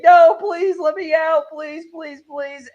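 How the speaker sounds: high panicked begging, loud then fading; retold screaming plea. Kid begs as the airlock counts down, retold screaming. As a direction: imitate a trapped kid pleading. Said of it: "like, screaming".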